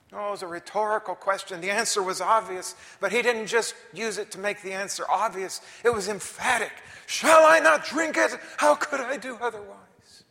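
loud, high, driving; preacher at full force; emphatic preaching. Acted. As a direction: insist it was emphatic.

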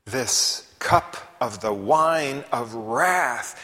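slow and loud, weighing each word; slow emphatic repeat. Real, not acted. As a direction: drag it out for weight. Repeats scripture phrase.

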